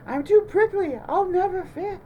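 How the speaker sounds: high, loud fretting critter voice; worried Porcupine. A narrator's reading. Porcupine frets to Bunny he won't fit, high and loud. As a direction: squeak out the worry.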